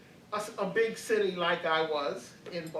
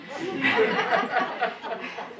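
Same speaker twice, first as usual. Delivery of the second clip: a louder, breathy real laugh; amused laugh; 3 semitones higher, 6 dB louder than usual. Real, not acted. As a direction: laugh at the funny picture. Laughs at the seventy-year-old tourists image.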